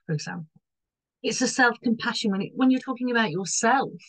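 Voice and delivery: ordinary talk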